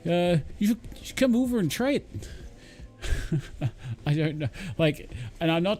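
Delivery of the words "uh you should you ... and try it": high, hammed-up Canadian accent; badly faked, folksy Canadian voice; terrible Canadian accent. Imagined Canadian neighbor offering homemade maple syrup, terrible Canadian accent. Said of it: "that was my terrible Canadian accent".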